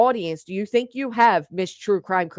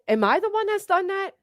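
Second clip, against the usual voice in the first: indignant high-pitched challenge; incredulous high demand; 10 semitones higher than usual. Real, not acted. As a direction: voice jumps way up in disbelief. Angry rhetorical challenge.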